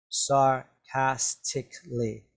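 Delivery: "Sarcastically" slowly broken into sar-cas-tic-ly; syllable by syllable. Shown as a demonstration. Teacher showing learners the sounds in "Sarcastically", syllable by syllable. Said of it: "There's four sounds".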